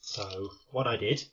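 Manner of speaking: panting after running up the stairs; breathless, catching your breath between words. Real, not acted. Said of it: "hence the reason why I'm out of breath".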